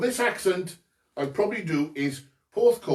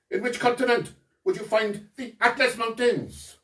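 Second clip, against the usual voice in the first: put-on higher-pitched North Wales accent; 5 semitones higher than usual. Quizmaster asking about Atlas Mountains, in a North Wales accent. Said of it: "That was my North Wales impression".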